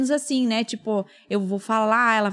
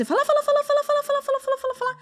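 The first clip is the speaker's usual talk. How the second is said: very high singsong chain of "falar", fading out; endless talking; faster than usual. Acted. Explaining therapy's magic to the hosts, a high singsong repetition. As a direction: rattle "falar" off like a wind-up toy.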